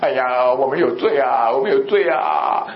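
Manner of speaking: imitated sinner's drawn-out moan; mock penitent lament. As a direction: wail it half-heartedly, stretching the endings. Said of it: "结果他就说". (拖着长音模仿认罪哀叹)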